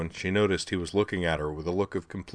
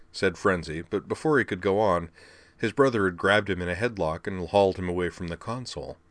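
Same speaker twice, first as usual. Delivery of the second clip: usual speaking voice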